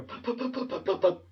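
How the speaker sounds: quick puffing stuttered breaths; mock hitching breaths before a cry; imitated pre-cry breaths. Kid trying not to cry, puffing pre-cry breaths. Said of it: "those pre-cry breaths".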